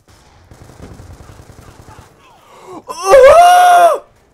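long groan rising into a very high scream; real scream of exasperation; frustrated scream. Real, not acted. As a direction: swell into a piercing, loud wail. Dies in game.

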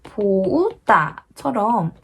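보우따 said slowly as bo-u-tta; pron 보우따. Shown as a demonstration. Teacher shows how to say 보우따. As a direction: stretch 보우따 into three beats. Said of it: "꼭 보우따처럼 좀 발음해주시면".

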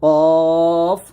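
long heavy "কফ"; thick. Shown as a demonstration. Teacher demonstrating the heavy letter, long thick "কফ". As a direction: thicken and stretch "কফ". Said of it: "কফ মোটা হবে".

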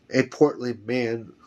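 usual speaking voice

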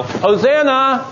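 high, drawn-out yell of praise; crowd yelling. Acted. Thousands hailing Jesus at the triumphal entry, yelling. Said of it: "all these thousands of people yell".

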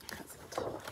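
soft secretive whisper. Acted. To the children about the snail, a whisper. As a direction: breathe the name like a secret. Whispered aside while reading.

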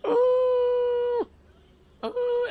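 high, drawn-out squealing ooh. Real, not acted. Streamer eyeing a raw steak, high alarmed squeal.

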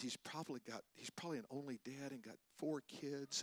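lightly voiced wife, very quiet; soft pleading for the waiter, barely above a murmur; wife's hushed reply. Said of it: "She said".

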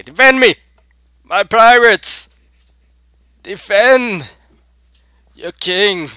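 high, loud, hammed-up battle cry; rally your crew with theatrical shouts; dramatic pirate king. King calling pirates to defend him, mock-dramatic. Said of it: "I'm just making this dramatic".

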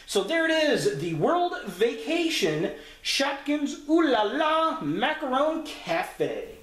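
high, sing-out presenter opening, title drawn out; video intro announcer. Acted. Host greets YouTube with a new pickup video, high announcer voice. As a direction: announce it high, stretching the product name slowly. Closes review announcing the product name.

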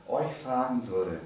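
normal talking voice

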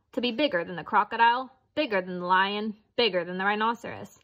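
ordinary delivery

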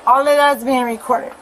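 loud, fast, heated warning; loud and agitated. Real, not acted. Angry woman warning officers she's recording them, loud. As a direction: talk over the officer, loud. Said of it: "you're being too loud".

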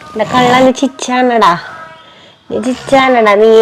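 fond cooing. Real, not acted. Mother coos at laughing son.

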